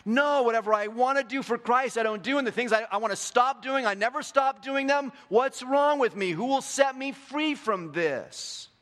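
high, loud frustrated plea, slowing at the end; frustrated Paul. Retold or imitated. Preacher voicing Paul's frustration at himself, high and loud. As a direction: cry out in exasperation at yourself. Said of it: "He's like".

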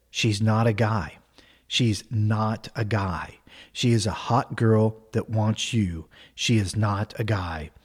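slow, low, soft self-reassurance, acted out; calm mantra. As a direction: recite it slowly like a private mantra. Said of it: "he's very calm and he's very cool".

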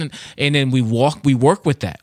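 ordinary talk, nothing marked

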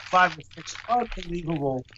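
eating while talking; mouth full. Real, not acted. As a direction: talk through a mouthful. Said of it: "All I hear is chips".

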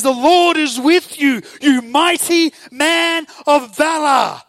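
booming, slow angelic proclamation; huge heavenly herald's voice; massive angel. Acted. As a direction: shout it out high and grand, drawing out the words. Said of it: "massive angel turns up".

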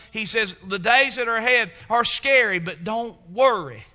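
booming divine encouragement, building; God voice, rising. Acted. God's voice encouraging Joshua.

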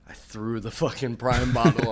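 ordinary talk, nothing marked

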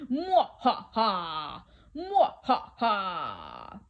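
slow villain cackle, drawn-out last "ha"; evil scary laugh. Shown as a demonstration. Storyteller showing children an evil laugh, slow and scary. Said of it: "a very evil, scary laugh".

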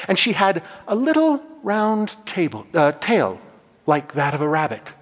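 ordinary talk

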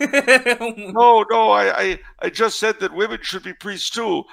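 high, stammered mock protest; comic high-pitched nun, starting loud; protesting old nun. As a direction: plead innocence as a hammed-up nun. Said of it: "the old Spanish nun".

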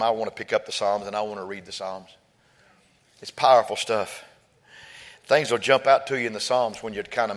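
gentle fireside reflection; soft reflective. Real, not acted. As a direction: lean in and muse quietly. Soft reflective aside on psalms.

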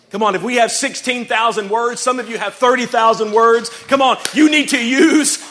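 high, very loud rallying preacher; full-force preaching. Real, not acted. Preacher urging the church to speak life, full-force shouting. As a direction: drive each line home with a shout.

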